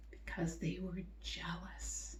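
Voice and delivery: soft whisper on jealous. Real, not acted. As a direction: lean in and whisper it. Teacher softens on jealousy.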